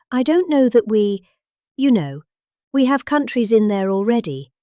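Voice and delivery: usual speaking voice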